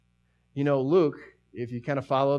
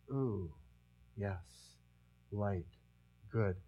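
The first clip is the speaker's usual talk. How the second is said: soft realization; 6 semitones lower, 12 dB quieter, much slower than usual. Retold or imitated. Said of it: "It's like".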